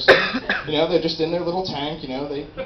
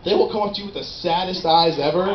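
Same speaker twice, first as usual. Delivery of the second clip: ordinary talk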